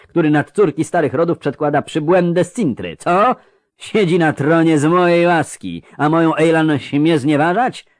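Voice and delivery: mounting indignation of an affronted father; an acted, restrained roar of protest; booming, roaring outrage. A narrator's reading. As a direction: build through outraged rhetorical questions. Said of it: "zaryczał ten basowy dudniący".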